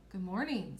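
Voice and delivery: slightly deepened storybook prince. Acted. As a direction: drop the voice a little for the prince. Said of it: "I made my voice kinda deeper".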